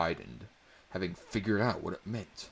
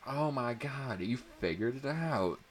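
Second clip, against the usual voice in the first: high, delighted squeal; thrilled he solved it; excited; 7 semitones higher than usual. A narrator's reading. Girl hearing he solved the code, excited. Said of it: "Abby excitedly screamed".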